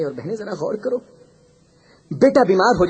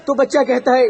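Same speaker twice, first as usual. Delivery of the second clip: normal talking voice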